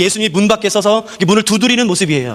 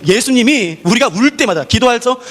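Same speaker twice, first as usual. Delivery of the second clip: ordinary delivery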